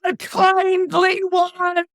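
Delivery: high, loud, slow cry of dread; terrified shout. Acted. Orestes fleeing into the night, shouting the Furies' name in dread. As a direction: howl the name as you flee. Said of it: "he cries out".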